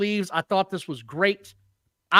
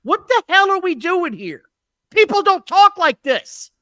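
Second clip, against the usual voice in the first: high, loud exasperated outburst; exasperated disbelief; 9 semitones higher, 5 dB louder than usual. Real, not acted. Podcaster complaining about scripted dialogue, high exasperated disbelief. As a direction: protest it with pitch jumping up.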